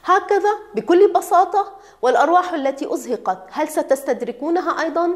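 loud, high-pitched indignant questions; angry. A narrator's reading. Doctor challenges director over lives lost, angry. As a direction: throw the questions back in anger. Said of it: "قال بغضب".

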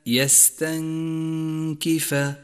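"يستنكف" chanted, n hidden in a long nasal hum before k. Shown as a demonstration. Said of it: "حكم الإخفاء في كلمة". («يستنكف» بإخفاء النون مع الغنة)

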